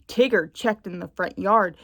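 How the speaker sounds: ordinary delivery, nothing marked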